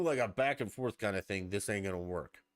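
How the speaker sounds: ordinary delivery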